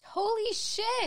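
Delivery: stunned. Real, not acted. Shocked at couch-eating story.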